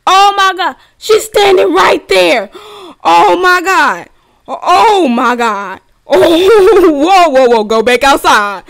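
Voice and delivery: loud, high shrieks with gasps; panicked screaming. Real, not acted. Player seeing Granny standing right there, panicked screaming. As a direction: shout in terror, gasping between cries.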